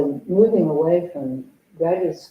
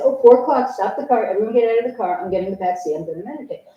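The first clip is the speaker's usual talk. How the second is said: higher, louder bossy orders; bossy announcement; 6 semitones higher, 5 dB louder, faster than usual. Retold or imitated. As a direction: order everyone out of the car. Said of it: "and I'd say".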